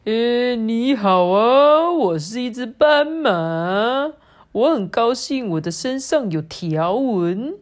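slow, loud, swooping singsong greeting; pig posing as zebra, showing off. Acted. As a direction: stretch each phrase end proudly. (小猪假扮斑马，拖长音大声炫耀)

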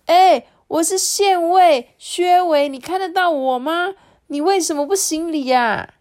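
高声尖起嗓子，开头又慢又急切; 变成鱼的县尉急着要人认他; 着急喊话. A narrator's reading. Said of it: "这时候我急着对张弼大人说". (薛维着急地高声向张弼大人喊)

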